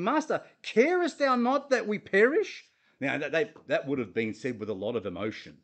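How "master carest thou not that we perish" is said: high, loud frightened cry; desperate plea. Acted. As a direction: plead as if drowning. Disciples crying out in storm.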